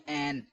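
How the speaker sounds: عین said short as a plain "ain"; quick everyday عین, with no throat sound or hold; common mispronunciation. Shown as a demonstration. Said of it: "اکثر لوگ کیا پڑھتے ہیں".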